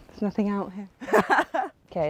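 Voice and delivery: normal talking voice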